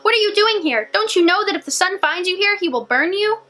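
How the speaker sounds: put-on high, urgent old woman's warning; alarmed warning. A narrator's reading. Old lady warning the girl about the sun, alarmed. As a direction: hurry the girl with fear in the voice.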